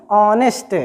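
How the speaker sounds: "ऑनेस्ट" with no H at the front; silent H. Shown as a demonstration. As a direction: begin "ऑनेस्ट" straight on the vowel. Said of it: "आप इसको कभी मत कहिएगा हॉनेस्ट".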